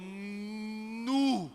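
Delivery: long-held, swelling exclamation of amazement; hammed-up Mineiro astonishment, slowly building; amazed "nu". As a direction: draw the word out in mock awe. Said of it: "é a evolução do nó".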